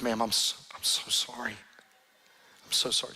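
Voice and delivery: broken, whispered apology; weeping man. Retold or imitated. The man, moved, apologizes in a tearful whisper. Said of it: "He starts weeping".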